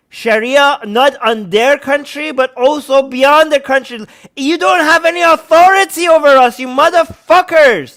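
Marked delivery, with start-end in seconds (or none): 4.4-8.0 loud, high, angry shout; real raised-voice fury; furious outburst. Real, not acted. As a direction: shout them down, dragging out the insult. Furious rant at would-be censors.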